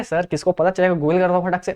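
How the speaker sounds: low aside from a student; student suggesting a shortcut. Acted.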